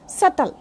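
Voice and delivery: subtle as SUT-ul, silent b; correct pronunciation. Shown as a demonstration. Teacher closing the lesson on subtle, silent b. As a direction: leave the b out of subtle. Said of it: "b is silent".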